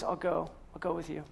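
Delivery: very soft, gentle promise, almost hushed; gracious Jesus. Retold or imitated. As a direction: say it quietly, with grace, like a gift. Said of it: "Jesus so graciously says".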